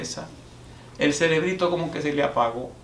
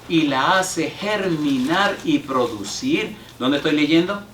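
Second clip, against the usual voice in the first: slow, swelling, louder toward the end; declaimed scripture reading; 2 semitones higher, 5 dB louder, much slower than usual. Acted. Pastor to congregation, proclaiming Isaiah 55. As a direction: build the verse to a proclaiming peak. Reads Isaiah 55 with rising force.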